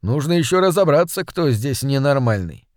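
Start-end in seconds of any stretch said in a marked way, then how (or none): none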